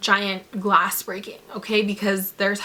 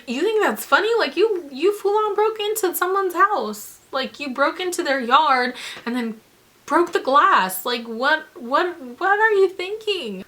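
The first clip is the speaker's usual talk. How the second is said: indignant retort; 7 semitones higher than usual. Retold or imitated. Retort to girls who laughed.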